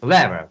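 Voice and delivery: CLEV in "Clever" punched loud, -er left weak; stress on first syllable. Shown as a demonstration. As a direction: hit the first syllable of "Clever" hard, let the second fall. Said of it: "The first syllable is stressed".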